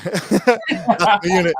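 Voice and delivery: laughing. Real, not acted. Laughing at the retold reply.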